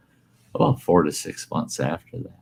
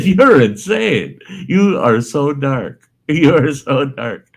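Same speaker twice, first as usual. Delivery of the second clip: higher, louder, slow, laughing; laughing disbelief; 9 semitones higher, 5 dB louder, slower than usual. Retold or imitated. Telling his brother he's so dark, laughing. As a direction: tease him while laughing.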